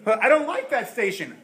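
loud protest; complaining believer. Acted. Believer protesting to God about his station, loud complaint. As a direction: whine it loudly at God.

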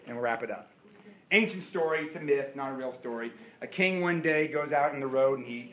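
usual speaking voice

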